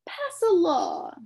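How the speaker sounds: slow, high, loud cheerful bug; brightly. A narrator's reading. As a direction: suggest it brightly. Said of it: "said the humbug brightly".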